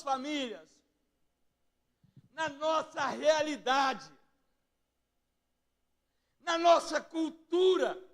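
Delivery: high, ringing pulpit proclamation; impassioned preaching. Real, not acted. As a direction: preach each phrase high, with pauses between. Preaches at full force.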